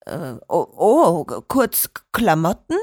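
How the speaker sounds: flustered stammer. A narrator's reading. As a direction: stumble over the words, caught off guard. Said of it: "stotterte Schorsch".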